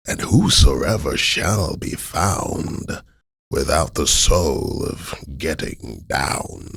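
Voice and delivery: slow, dramatic horror narrator; Vincent Price voice. Retold or imitated. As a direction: deliver it like a spooky movie monologue. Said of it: "the Vincent Price voice comes in".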